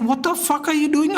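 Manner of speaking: high, exasperated retold yell; angry outburst. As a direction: blurt it out in disbelief at the screen. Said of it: "I got so mad when I saw the tackle".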